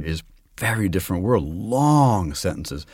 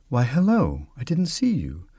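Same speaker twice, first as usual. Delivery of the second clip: a little higher; 4 semitones higher than usual. Shown as a demonstration. Actor wanting to hear the lady, a little higher. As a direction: gently lift her above the narrator. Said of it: "You put it a little higher".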